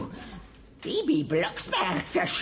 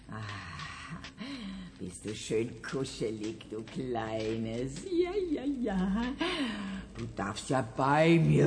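soothing; 3 semitones lower than usual. Acted. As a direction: hush the kitten gently. Manja cuddles the kitten.

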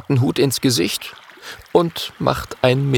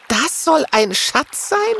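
much higher, slow doubtful porcupine voice; disappointed porcupine; slower than usual. Acted. Porcupine staring into the chest, disappointed and doubtful. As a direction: let the letdown drag the question out slowly.

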